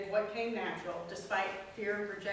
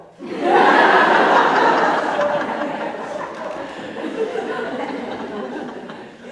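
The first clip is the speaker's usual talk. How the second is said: loud real laugh; 5 semitones higher, 18 dB louder than usual. Real, not acted. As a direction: burst of breathy laughter. Laughing at own story.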